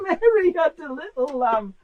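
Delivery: slow, giggling through the words; can barely get it out for laughing. Real, not acted.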